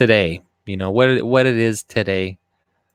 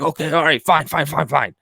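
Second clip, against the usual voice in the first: caving-in voice; 6 semitones higher than usual. Retold or imitated. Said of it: "He's like".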